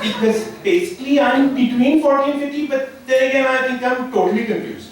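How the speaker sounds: a hint of an Indian accent, slowed and stretched. Comic answering his own age question with a light Indian accent. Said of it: "three words that Indians love to use".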